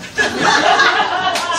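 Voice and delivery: short high burst of laughter; real laugh. Real, not acted. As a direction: crack up at the joke. Laughs at the sword joke.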